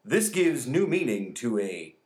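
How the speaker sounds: slow news read with a punched ending; measured anchor-style read. Acted. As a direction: try on a newscaster's delivery. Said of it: "I'm gonna try one".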